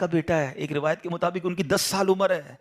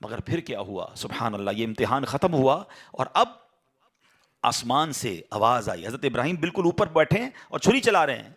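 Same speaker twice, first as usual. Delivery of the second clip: ordinary talk, nothing marked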